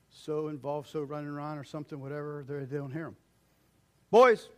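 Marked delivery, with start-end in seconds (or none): 4.1-4.5 loud, high call across the house; yelling. Retold or imitated. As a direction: call out to the boys. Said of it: "Notice how the voice goes down".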